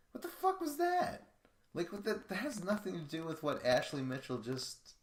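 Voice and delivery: high-pitched, exasperated disbelief; indignant, voice leaping way up; baffled outburst. Real, not acted. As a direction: burst out in loud, squeaky bafflement. Baffled rant at Camilla's remark.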